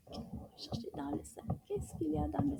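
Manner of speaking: put-on hushed, secretive question to the kids; playful whispered quiz question. Whispering while searching the bag.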